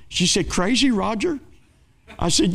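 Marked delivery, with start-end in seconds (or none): 0.5-1.4 higher, incredulous question; can't believe her ears; astonished disbelief. Retold or imitated. Loan officer asking the preacher in disbelief, higher and astonished.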